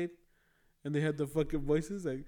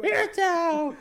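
high, loud, raspy cartoon squawk; raspy squeaky impression; 12 semitones higher, 6 dB louder, slower than usual. Friend showing the raspy squeaky voice, loud high squawk. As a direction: squawk it with rasp behind the squeak. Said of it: "he has that raspy voice".

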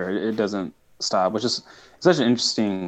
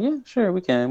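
high eager agreement; 11 semitones higher than usual. Retold or imitated. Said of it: "And they're like".

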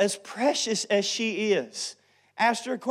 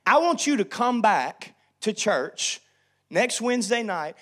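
normal talking voice

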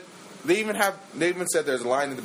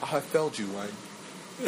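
British butler confessing, sinking low and soft; put-on British accent, dropping away in regret; Alfred; 3 semitones lower, 6 dB quieter, much slower than usual. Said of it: "You sound like Alfred".

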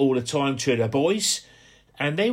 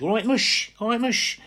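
high, bouncy Portsmouth lad's hello; imitating Portsmouth mates greeting a pal; Portsmouth mates' greeting; 9 semitones higher than usual. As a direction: call out to a mate in broad Portsmouth. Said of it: "All, all your mates were Mush".

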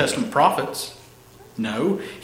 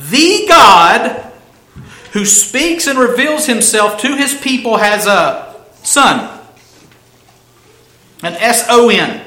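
high, loud, forceful preaching; emphatic proclamation; 6 semitones higher, 7 dB louder than usual. Real, not acted. Preacher warning not to pass by the phrase, emphatic.

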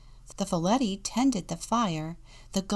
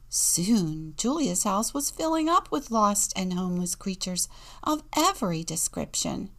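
ordinary delivery

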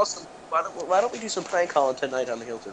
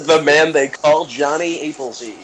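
slow, louder announcer breaking into a laugh; dramatic announcer; 9 dB louder than usual. Pretend. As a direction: announce it grandly, laughing midway. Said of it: "say before everybody's name".

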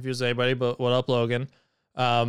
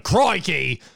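mock Australian accent; 5 semitones higher, 3 dB louder than usual. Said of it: "the over-exaggerated Australian accent".

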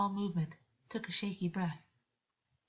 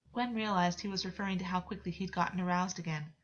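usual speaking voice

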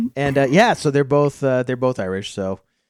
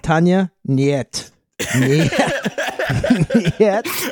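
put-on Russian accent; 4 semitones higher, slower than usual. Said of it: "You're like".

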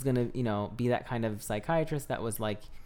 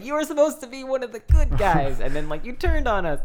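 higher and louder mock outrage at a traitor; betrayed outcry; 11 semitones higher, 8 dB louder than usual. Fan scolding the psychiatrist for turning, loud mock outrage. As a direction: cry out as if the good guy just betrayed you. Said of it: "I was just like".

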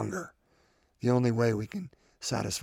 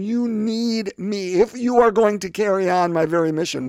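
higher, louder quoted plea; voicing Jesus urgently; 6 semitones higher, 5 dB louder than usual. Preacher quoting Jesus to the congregation, urgent and raised. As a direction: raise the voice to press Jesus's words. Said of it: "He's saying".

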